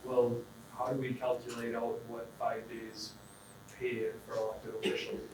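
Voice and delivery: usual speaking voice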